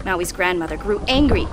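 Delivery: plain reading voice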